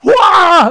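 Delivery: high, loud crowd roar; acted sports-fan shout; fan's cheer. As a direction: whoop as if the winning shot dropped. Acts basketball fan at buzzer beater.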